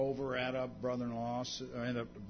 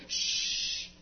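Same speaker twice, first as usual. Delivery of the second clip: shushing. Retold or imitated. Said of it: "were telling him that".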